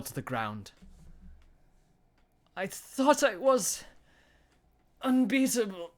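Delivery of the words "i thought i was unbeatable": dying words. A narrator's reading. Dying villain's last words.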